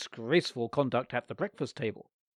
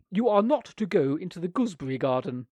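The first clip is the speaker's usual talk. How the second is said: aunt's firm order; 4 semitones higher, 6 dB louder than usual. A narrator's reading.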